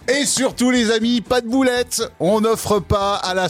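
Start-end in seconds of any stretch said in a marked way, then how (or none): 0.1-2.0 laughing through the words. Real, not acted. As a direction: giggle while repeating it. Repeats it while laughing. (en riant)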